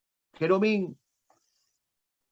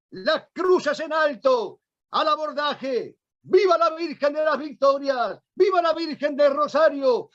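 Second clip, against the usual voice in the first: shouting battle orders; 6 semitones higher than usual. Retold or imitated. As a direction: roar the orders to the fleet. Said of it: "gritaba Juan de Austria".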